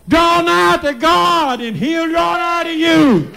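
very high, slow, stretched cry; climactic preaching shout. Real, not acted. Preacher cries out to the congregation, high and slow. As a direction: sing it out to the back pews.

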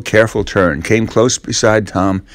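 ordinary delivery

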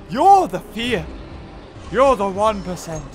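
loud, punched accusation; loud scolding. Real, not acted. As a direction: call them out hard. Scolding the dislikers.

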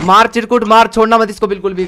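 loud urgent battle cries; excited shouting. Real, not acted. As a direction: shout orders at teammate. Urging teammate to kill.

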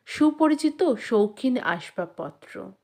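ordinary delivery

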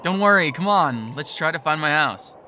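excited call. Acted. Mark calls to his scared friend from the rooftop edge, loud and thrilled. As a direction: call over the wind, thrilled.

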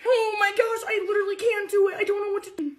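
flustered and giggly; panicky whine. Real, not acted. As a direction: near tears in real panic. Wax stuck, panicking.

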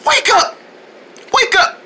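high-pitched, desperate call; panicked urging. Acted. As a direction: urgently try to rouse a friend. Trying to rouse the fallen puffin.

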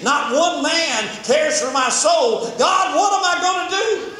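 crying out loud and high to God; David calling desperately to God from the cave; anguished shouted prayer. Retold or imitated. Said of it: "David is sitting in the cave and thinking".

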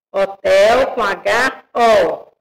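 slow, deliberate "Hotel com H O"; teacher stressing the letters H and O; slow spelling out. Shown as a demonstration. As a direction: spell it out slowly for the class. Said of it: "Olha a separação".

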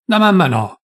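the doctor insisting loudly; firm denial. Acted. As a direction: reassure her forcefully. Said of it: "negò subito forte il dottore".

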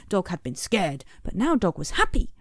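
usual speaking voice

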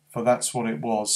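ordinary delivery

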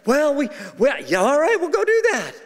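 high-pitched, hammed-up yes; mock eager agreement. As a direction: imitate a couple eagerly giving in. Acted couple agrees to go.

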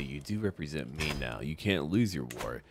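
ordinary talk

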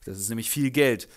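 ordinary delivery

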